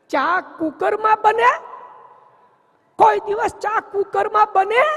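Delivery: can you believe this, scoff at it; incredulous. Retold or imitated. Incredulous reply to tea in cooker. (ભાઈ અવિશ્વાસથી ઊંચા અવાજે પૂછે છે)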